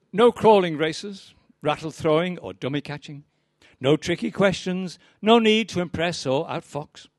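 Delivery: measured, rhythmic poetry reading; poem recital. Acted. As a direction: recite it like a stage poet, each line landing. Reads poem The Overall Winner.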